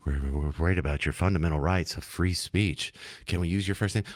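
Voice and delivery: low, soft, calming host voice; mock radio interviewer. Host mocking an interviewer asking about names, hushed voice. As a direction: imitate a hushed, concerned NPR interviewer. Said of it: "it's kinda calming? Like, it's supposed to be scary".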